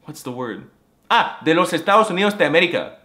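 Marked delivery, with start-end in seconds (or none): 1.1-2.9 fast, loud Spanish blurt; slipping into Spanish. Acted. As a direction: rush it out in Spanish. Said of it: "accidentally switching to Spanish".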